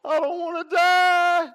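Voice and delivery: put-on high, loud, slow wailing little boy; crying child. Boy crying to his mom about death, high wailing sob. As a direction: wail it like a scared five-year-old. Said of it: "I'm in the bedroom crying".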